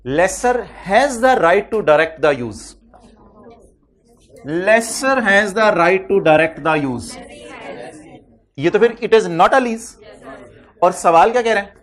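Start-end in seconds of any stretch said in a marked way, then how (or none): 0.1-7.2 very slow, clear words for students to note; slow dictation. Shown as a demonstration. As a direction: read the rule out word by word. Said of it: "reading the condition aloud". (बहुत धीरे बोलकर लिखवाना)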